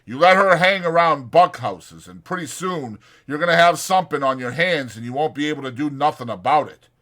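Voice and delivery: loud, fast and contemptuous; scornful scolding. Acted. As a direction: lecture him with scorn. Carlson scolds Curly.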